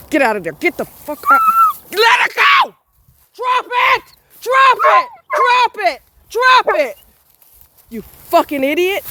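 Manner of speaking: real furious high shout of drop it; yelling at the dog. Real, not acted. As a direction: command the dog with full anger.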